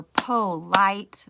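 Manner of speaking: "polite" slowly split in two beats, po, lite; syllable by syllable. Shown as a demonstration. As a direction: clap out "polite" as two separate, drawn-out syllables. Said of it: "we clap out our words to see how many syllables they have".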